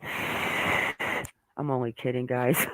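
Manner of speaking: mock joke voice. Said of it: "And then be like".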